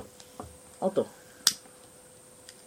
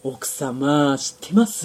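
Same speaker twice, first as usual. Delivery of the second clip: gossiping auntie; 8 semitones lower, slower than usual. Pretend. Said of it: "噂話を聞くおばさんになってください".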